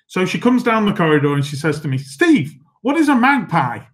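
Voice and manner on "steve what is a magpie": high-pitched imitation of the cartoon maid's accent; amateur impersonation of a cartoon maid's accent; Tom and Jerry maid accent. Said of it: "I'll stop doing the accent there".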